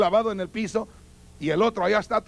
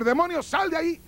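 commanding cry; 8 semitones higher than usual. Retold or imitated. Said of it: "Uno le puede decir".

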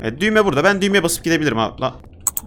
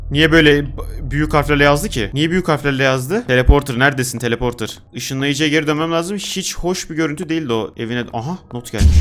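usual speaking voice

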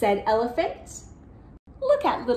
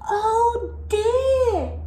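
high, worried exclamation; storybook distress, pitched high; acted dismay; 9 semitones higher, 3 dB louder than usual. A narrator's reading. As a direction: fret aloud over a friend in danger. Snake reacts to elephant in danger.

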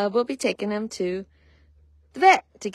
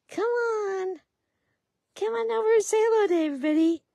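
much higher, quick coaxing; 12 semitones higher than usual. Real, not acted. Owner coaxing an upset filly, high baby talk. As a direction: lure her over in a high sweet voice.

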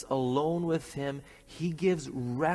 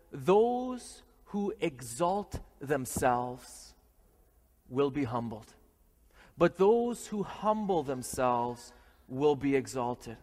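solemn scripture reading; 3 semitones lower, slower than usual. Acted.

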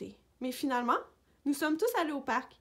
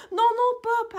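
high, loud repeated protest; shouting; 12 semitones higher, 12 dB louder than usual. A narrator's reading. Sam joins the tug-of-war over the cushion, high shout. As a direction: shout urgently to stop the dog. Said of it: "a crié Sam".

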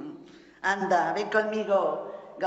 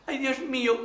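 ordinary talk

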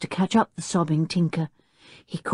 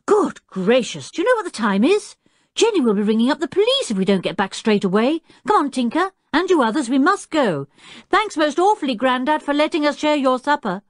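put-on higher, hurried boy's voice, faking alarm; pretend horror; 9 semitones higher than usual. A narrator's reading. Julian hurrying Tinker away from Grandad's supper, mock horrified. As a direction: act shocked at the late hour. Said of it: "pretended to be horrified at the time".

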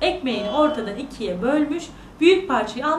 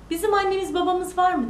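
put-on higher-pitched, innocent child's question; lightly voiced wondering little girl; little sister; 7 semitones higher than usual. Sister asks the mirror about parents, wondering.

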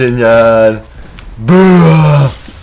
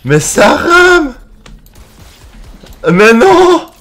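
high, slow exasperated cry; frustrated high yell; 9 semitones higher, slower than usual. Real, not acted. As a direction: wail at the lag. Game lagging, frustrated yells.

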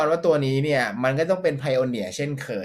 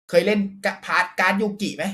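ordinary talk

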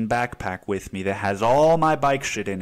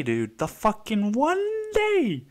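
exasperated; 10 semitones higher, slower than usual. Real, not acted. Exasperated rant about flat tire.

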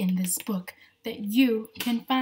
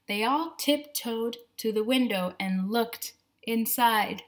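plain reading voice

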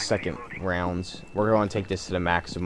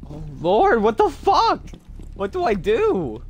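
high, loud panicked cry; panicked shriek; 13 semitones higher, 5 dB louder, slower than usual. Real, not acted. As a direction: freak out in terror. Swarmed, no ammo.

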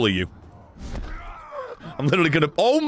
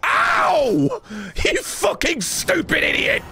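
high-pitched yelp, then cursing; furious, pained shout; angry outburst; 14 semitones higher, slower than usual. Real, not acted. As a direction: shout it in a sudden high fury. Hurt in game, curses culprit.

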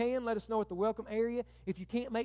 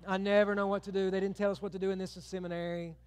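ordinary talk, nothing marked

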